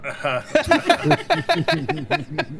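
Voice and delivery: high-pitched burst of laughter. Real, not acted. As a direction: let out a high giggle. Player laughs at own joke.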